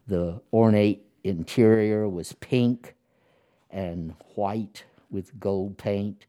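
usual speaking voice

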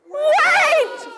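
high shouted wait. Acted. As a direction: cry out to stop everything. Cascade of wait cries.

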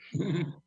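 short high nervous laugh. Real, not acted. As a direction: laugh it off, embarrassed. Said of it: "Sorry, I'm nervous".

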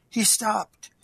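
ordinary delivery, nothing marked

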